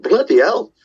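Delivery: a retold gasp of disbelief; mild shocked exclamation. Hearing that people liked the song, mildly shocked. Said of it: "I was shocked".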